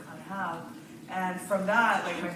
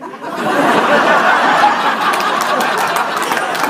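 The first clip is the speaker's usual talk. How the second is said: loud breathy laugh; 6 semitones higher, 17 dB louder than usual. Real, not acted. Laughs at brother's refusal.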